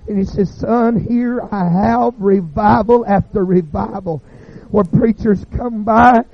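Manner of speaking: high, loud, fiery preaching of God's judgment; preaching at full force. Acted. Preaching climax, God's verdict.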